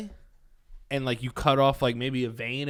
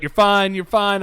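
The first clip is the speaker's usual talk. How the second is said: higher, much louder hurried reassurance; calm the patient down, loudly; reassuring doctor; 6 semitones higher, 10 dB louder than usual. Retold or imitated. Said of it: "the guy was like".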